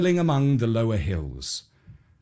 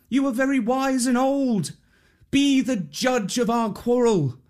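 pleading priest; 10 semitones higher than usual. Acted. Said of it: "the man cried out to a big tree".

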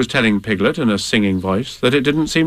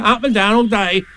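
higher, bouncy character voice; raised, blustery animal character; Tigger's voice; 10 semitones higher than usual. Acted. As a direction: bluster it like a confident braggart. Tigger keeps boasting.

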